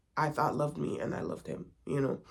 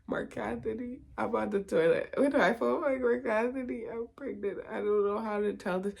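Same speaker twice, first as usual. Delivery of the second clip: higher-pitched, mock-distressed phone voice; retold panicked confession; 5 semitones higher than usual. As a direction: blurt it like a nervous confession, imitating yourself. Retelling her panicked phone confession.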